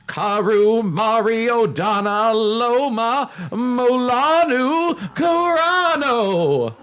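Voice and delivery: very slow, high, loud chanting; incantation. Acted. Reading the dummy's spell aloud, very slow, high incantation. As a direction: intone the spell like a sorcerer. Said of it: "she read the strange words aloud".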